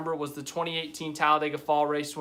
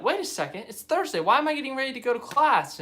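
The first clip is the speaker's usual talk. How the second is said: high-pitched puzzled self-quote; mock sudden realisation; 9 semitones higher, 3 dB louder than usual. Telling viewers about mixing up the days, mock baffled. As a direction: imitate your own baffled double take, pitch jumping high.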